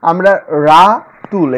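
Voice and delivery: Ratul slow and loud, parted into রা-তুল; loud, slow teacher demo; syllable split. Shown as a demonstration. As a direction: stress the two parts of Ratul. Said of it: "এখানে দুটি syllable আছে".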